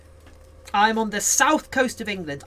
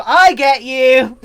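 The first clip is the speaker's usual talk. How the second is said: laughing; 5 semitones higher, 10 dB louder, slower than usual. Real, not acted. Laughing in agreement.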